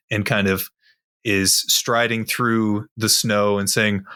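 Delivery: normal talking voice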